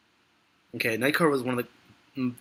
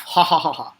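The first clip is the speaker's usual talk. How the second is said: forced laugh; 4 semitones higher, 9 dB louder than usual. Pretend. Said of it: "Come on, guys, laugh".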